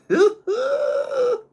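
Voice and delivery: very high, loud squeal of laughter; helpless high laugh; real laughing fit. Real, not acted. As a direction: break down giggling mid-read. Cracks up during a read.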